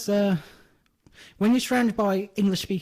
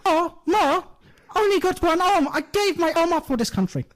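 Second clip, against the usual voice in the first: much higher, louder Irish wail; mock Irish wail; 10 semitones higher, 4 dB louder than usual. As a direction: overdo a wounded patriot's lament. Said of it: "I was doing both parts".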